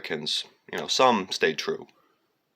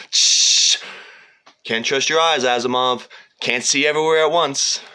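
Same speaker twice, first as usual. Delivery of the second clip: loud, higher, intense line delivery; quoted anime villain; 7 semitones higher, 10 dB louder than usual. Retold or imitated. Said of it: "You remember Asteroid Blues?".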